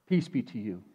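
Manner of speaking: Jesus offering peace to the disciples; gentle blessing. Acted. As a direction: hand it over tenderly. Risen Jesus speaks to disciples.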